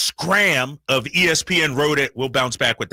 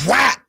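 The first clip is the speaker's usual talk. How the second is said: mock slap sound; 12 semitones higher, 6 dB louder than usual. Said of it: "somebody go".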